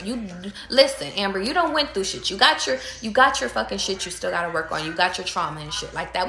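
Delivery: ordinary delivery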